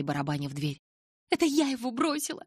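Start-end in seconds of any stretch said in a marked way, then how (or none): 1.3-2.4 much higher, declared; defiant declaration. A narrator's reading. Bella declares to Charlie she left Edward, much higher. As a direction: throw the claim at her father. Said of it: "заявила я".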